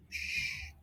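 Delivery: whispered shh; hushing. Acted. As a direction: hush them gently. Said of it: "hush sound".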